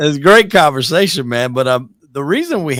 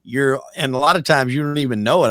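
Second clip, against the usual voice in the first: ordinary delivery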